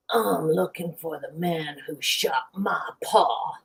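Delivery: slow, menacing cowboy line; slow Western showdown voice; gunslinger drawl. Acted. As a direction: deliver the punchline like a Western outlaw. Gunslinger dog punchline.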